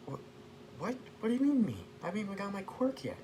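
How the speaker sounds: very high squeaky protest; startled boy. Acted. Boy pointed out by a robot, high flustered protest. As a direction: pitch shoots up in flustered mock disbelief.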